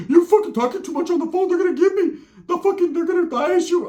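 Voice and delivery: squeaky high cartoon-mouse cousin; Mickey Mouse voice. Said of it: "put the Mickey Mouse to it".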